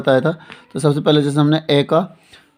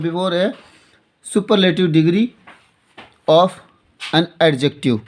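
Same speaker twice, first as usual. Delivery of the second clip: very slow, word by word; dictation pace; 2 semitones higher, much slower than usual. Shown as a demonstration. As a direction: give them time to write each word. Teacher dictating a rule to write. (धीरे-धीरे लिखवाते हुए)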